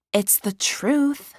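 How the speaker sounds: smug retort. A narrator's reading. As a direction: stand by it, unbothered. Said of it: "Willow retorted".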